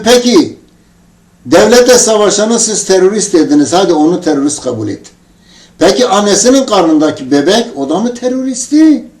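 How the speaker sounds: mildly acted outrage; indignant challenge. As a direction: press him, climbing to an incredulous question. Acted angry argument with the soldier.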